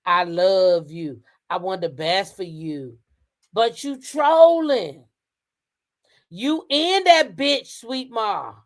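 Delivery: slow, drawn-out, emphatic quote; reading her words out. Retold or imitated. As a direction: read out her post word by word, slowly. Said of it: "She said".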